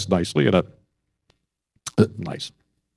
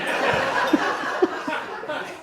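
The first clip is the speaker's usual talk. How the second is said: real laugh; 6 dB quieter than usual. Real, not acted. Laughing at his own story.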